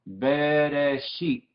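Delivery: Bereshit stretched syllable by syllable, very slowly; drawn-out pronunciation demo. Shown as a demonstration. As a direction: sound out Bereshit slowly, holding each vowel. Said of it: "queremos practicar la pronunciación". (Bereshit pronunciado muy lento, sílaba por sílaba)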